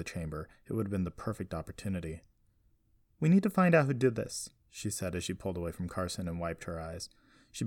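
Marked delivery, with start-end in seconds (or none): 3.2-4.5 higher and louder, pressing; urgent resolve. A narrator's reading. Jessie to Carson after her mother went missing, urgent. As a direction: push it out with urgency. Upset girl resolves to act.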